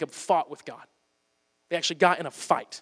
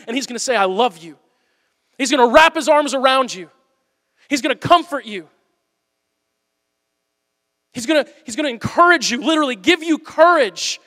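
higher, louder preaching, each line driven home; preaching at full force; 7 semitones higher, 8 dB louder than usual. Acted. Preacher urging the congregation to deal with God, fervent. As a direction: build the sermon's fire, hammering every phrase.

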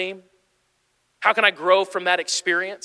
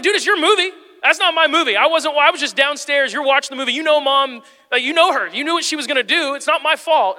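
loud, high mock-indignant arguing; raised-voice retold squabble; acted defensive protest; 9 semitones higher, 6 dB louder than usual. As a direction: protest your innocence to a sibling. Retold quarrel between brothers.

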